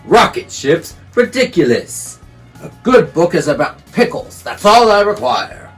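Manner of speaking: scoffing bear. Acted.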